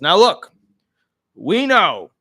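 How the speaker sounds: the government addressing taxpayers; federal government voice. Acted. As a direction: announce it like the authorities. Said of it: "they use a federal government voice".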